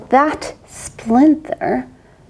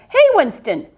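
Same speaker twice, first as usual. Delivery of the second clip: light bark; 7 semitones higher than usual. Acted. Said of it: "barked Rex".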